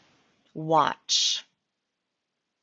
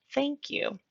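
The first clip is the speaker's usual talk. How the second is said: polite customer thanks; 9 semitones higher, 4 dB quieter than usual. Acted. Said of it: "the customer will reply".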